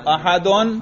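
"احدن" with the full -un ending sounded; اعراب کے ساتھ. Shown as a demonstration. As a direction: say "احدن" as a-ha-dun, ending clearly pronounced. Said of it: "اس کو اعراب کے ساتھ بولنا ہے". ("احدن" کے آخر میں دو پیش، یعنی اَحَدُن)

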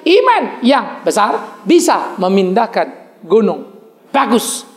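drawn-out repeat with iman stressed; slow repeat. Shown as a demonstration. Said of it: "Sekali lagi".

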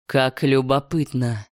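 deep, booming divine voice; godlike voice. A narrator's reading. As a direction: speak as a vast ancient dragon god. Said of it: "обрушился на меня божественный голос".

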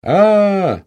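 long, loud, high "aah"; loud drawling "ah"; drawn-out exclamation. A narrator's reading. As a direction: stretch it out as understanding dawns. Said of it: "протянул Щука".

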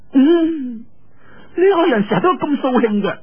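put-on high girlish voice, sulky complaint; pouting young girl. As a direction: grumble like a spoilt girl. Yue Lingshan sulking at Lin. (嬌嗔咁埋怨佢掃興)

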